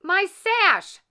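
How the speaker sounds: high loud outrage; indignant shriek; outraged cry. A narrator's reading. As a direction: Drizella spotting her sash. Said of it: "cried Drizella".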